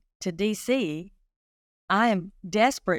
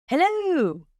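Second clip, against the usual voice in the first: a lightly voiced friendly constable, a bit higher; friendly hello; 9 semitones higher, 3 dB louder than usual. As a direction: mimic his cheerful phone greeting. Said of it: "He sounds so friendly".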